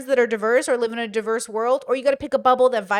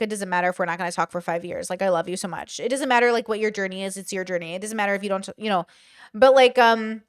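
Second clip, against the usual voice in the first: ordinary talk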